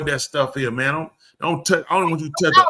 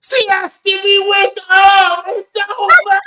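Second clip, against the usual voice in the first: drawn-out mock shriek of despair; hysterical, hammed-up agony; screaming; 8 dB louder, slower than usual. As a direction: imitate her high, loud wailing. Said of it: "she screamed in my head and imprinted she was dying".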